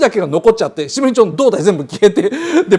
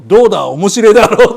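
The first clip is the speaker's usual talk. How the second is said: louder, proud punchline with a laugh; lame joke punchline; 8 dB louder than usual. Shown as a demonstration. Demoing the corny punchline for later use, louder. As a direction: sell the bad joke, louder. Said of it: "こういう下手なジョークの言い方".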